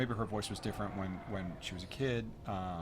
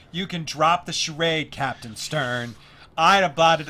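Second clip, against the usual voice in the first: put-on slow, loud, pitched-up kid reading; child actor's audition line; 7 semitones higher, 13 dB louder, slower than usual. Young girl reading her audition line to the director, slow and loud. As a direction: overact it the way a twelve-year-old would. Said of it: "She was like".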